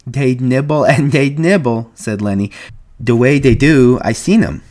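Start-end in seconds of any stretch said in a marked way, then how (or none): 0.1-1.9 put-on higher, louder childlike delight; gleeful. A narrator's reading. Lenny pictures the rabbits eating alfalfa, gleeful. 3.0-4.6 put-on louder childlike insistence; excited. A narrator's reading. Lenny tells George he has seen rabbits, excited.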